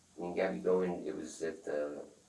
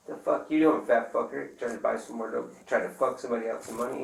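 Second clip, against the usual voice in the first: retold angry yell; 6 semitones higher, 8 dB louder than usual. Retold confronting Anthony on phone.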